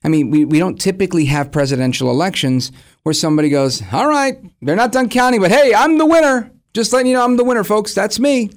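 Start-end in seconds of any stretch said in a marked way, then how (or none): 3.9-8.5 smug self-declared winner. Retold or imitated. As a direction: crow about winning early. Mock candidate claiming victory.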